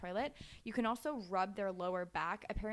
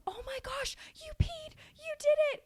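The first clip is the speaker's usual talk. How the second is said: much higher, thrilled cheering; going crazy with praise; 3 dB louder than usual. Retold or imitated. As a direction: celebrate the first pee like a huge win. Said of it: "you're going crazy".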